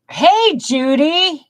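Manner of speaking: high, slow call out hello; drawn-out greeting. Real, not acted. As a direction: sing out the greeting warmly. Greeting a viewer by name.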